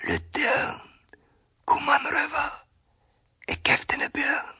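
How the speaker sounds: hushed tree voice in dialect; whispering tree. A narrator's reading. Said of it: "so flüstert der Baum".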